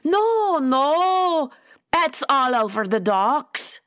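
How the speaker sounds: put-on high, drawn-out "no, no" in Scottish accent; flustered Scot. A narrator's reading. Nessie denying that she was told, flustered Scottish protest. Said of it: "Nessie's cheeks flared".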